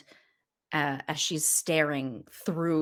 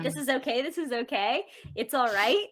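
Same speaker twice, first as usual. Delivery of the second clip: mock reassurance; 8 semitones higher, faster than usual. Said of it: "And you can say".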